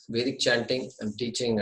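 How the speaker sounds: ordinary talk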